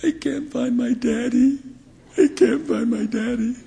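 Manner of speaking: a hint of a tearful girl; lost crying child. Lost girl telling the bishop she can't find dad, lightly teary. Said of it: "She said".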